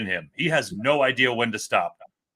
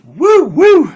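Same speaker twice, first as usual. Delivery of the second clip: much higher and louder joyful whoops; excited whoops; 15 dB louder than usual. Real, not acted. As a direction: cheer it out at the top of the voice. Cheering the defense's showing.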